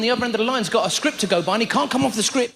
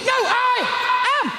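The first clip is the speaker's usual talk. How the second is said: grand shout; 8 semitones higher, slower than usual. Retold or imitated. Customer shouting the Spartacus line back at the caller. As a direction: declare it heroically. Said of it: "And we go".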